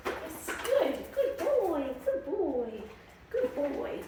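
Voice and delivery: high, eager praise; excited dog praise. Real, not acted. Trainer rewarding her dog for coming, high and eager. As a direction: lift the voice way up to reward him.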